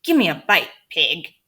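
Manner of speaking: lightly voiced rude parrot, pushy; a hint of a squawky bird sneering; mildly voiced parrot being rude. A narrator's reading. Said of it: "said rudely".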